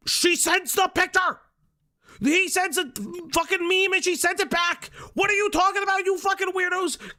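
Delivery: high, heated shouted rant; exasperated shouting. Real, not acted. Streamer defending a flirtation to critics, exasperated shouting. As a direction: yell your disbelief at the accusers.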